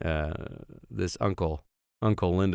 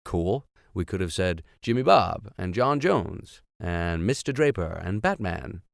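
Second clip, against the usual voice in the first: usual speaking voice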